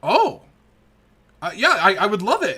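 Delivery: high, loud eager yelp; excited old orc. Acted. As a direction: jump at the offer gratefully. Orc excited about the water.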